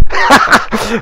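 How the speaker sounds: bursting into a high laugh. Real, not acted. Vlogger laughing at his own Scouse impression. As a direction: crack up at your own impression. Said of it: "I just spat everywhere".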